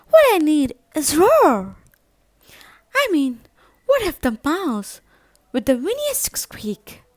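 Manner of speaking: high, loud, eager mouse voice; a tiny mouse bursting with a big idea; excited little mouse. Acted. Mouse in bed telling himself his plan, eager and loud.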